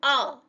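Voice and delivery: "O" as a short open o vowel; letter sound. Shown as a demonstration. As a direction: say "O" as the short o in octopus. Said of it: "We sound it as".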